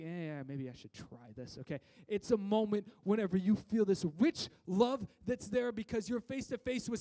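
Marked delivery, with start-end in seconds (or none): 0.0-1.8 reluctant mumble. Retold or imitated. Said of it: "you're going like".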